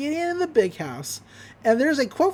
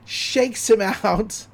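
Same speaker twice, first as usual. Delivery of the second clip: usual speaking voice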